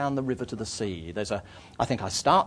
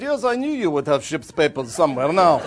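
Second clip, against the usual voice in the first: raised, louder satisfied official; Bulgarian harbour master; 5 semitones higher, 7 dB louder than usual. Acted. Border official accepting forged papers unsurprised, officious. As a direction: accept it briskly as a foreign official. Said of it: "He said".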